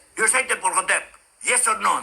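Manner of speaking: imitating a haughty lawmaker's snap; mock arrogant grilling. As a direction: lord it over the witness, mockingly. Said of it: "ang e-ere".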